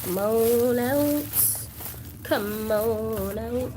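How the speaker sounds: drawn-out, stretched coaxing; coaxing call. Pretend. Doll collector coaxing a hidden doll out, playful drawn-out call. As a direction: call it out slowly, stretching each word.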